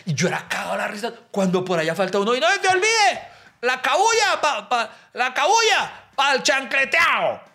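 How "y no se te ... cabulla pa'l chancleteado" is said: high, eager voice of a local explaining; chirpy Caribbean Colombian local; coastal local man. Retold or imitated. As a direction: play the helpful village guy.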